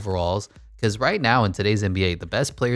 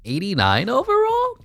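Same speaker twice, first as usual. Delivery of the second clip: high-pitched disbelieving question; incredulous; 4 dB louder than usual. Real, not acted. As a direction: shoot up high in real surprise at the number. Reacting to an unexpected rating.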